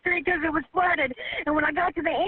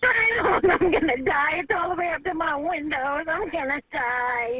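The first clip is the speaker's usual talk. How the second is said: slow, sobbing cry of despair; real panic, wailing out each word; terrified wail; slower than usual. Real, not acted. Caller sees water at her windows, terrified wail. Caller believes she is drowning.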